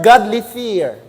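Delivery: normal talking voice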